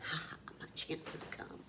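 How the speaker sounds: sly whispered aside; secret thought. A narrator's reading. Big Anthony gleefully thinking to himself, whispered. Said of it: "inside he was thinking".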